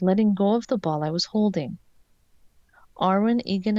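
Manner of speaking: ordinary delivery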